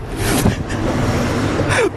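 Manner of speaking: high, silent wheezing laugh; helpless airy laughter; breathless laugh. Real, not acted. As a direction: laugh with no voice, just air. Laughing at the comment.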